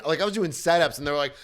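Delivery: ordinary delivery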